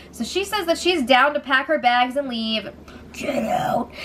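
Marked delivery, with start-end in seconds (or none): 3.1-3.9 soft, voiceless whisper; whispered aside. Real, not acted. Host reacts to Jess threatening to leave, whispered.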